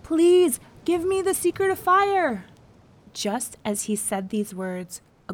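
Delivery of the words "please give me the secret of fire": far higher, loud plea over the storm; desperate shouted plea; pleading cry. Acted. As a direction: beg the sky for fire. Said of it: "he cried".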